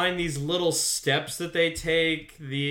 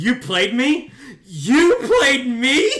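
louder and higher outrage, half laughing; laughing mock outrage, louder and higher; mock furious Faith; 9 semitones higher, 9 dB louder, slower than usual. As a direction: shout it as if betrayed, laughing. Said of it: "Faith is so pissed".

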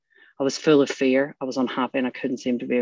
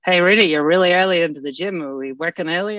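higher-pitched mock Australian trainer; bad Australian accent; 4 semitones higher, 5 dB louder than usual. Trainer greeting an early client at the gym, bad Australian accent. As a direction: imitate an Aussie trainer, badly. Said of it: "I do a really bad Australian accent".

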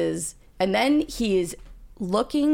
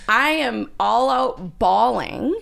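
ordinary delivery

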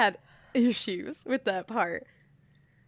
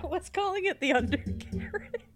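usual speaking voice

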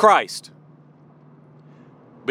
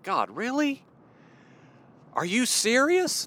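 incredulous plea; 8 semitones higher, 4 dB quieter than usual. Retold or imitated.

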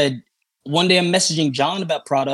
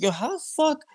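shocked; 11 semitones higher, 5 dB quieter than usual. Retold or imitated. Shocked message to Alex.

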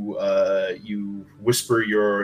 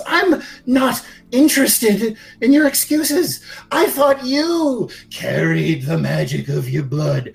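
high, sharp sneer, much higher than usual; smaller voice cutting off; 9 semitones higher than usual. Acted. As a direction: cut him off with scorn. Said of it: "the smaller voice sort of cuts it off and says".

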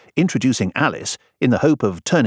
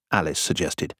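ordinary delivery

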